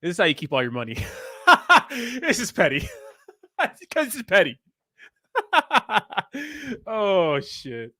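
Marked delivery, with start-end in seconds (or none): 1.0-2.2 high, loud burst of real laughter. Real, not acted. Co-host laughing at the petty bill story. 2.2-7.9 words pushed out through real laughter, pitched up; laughing while talking. Real, not acted. Co-host laughing that the charge is petty.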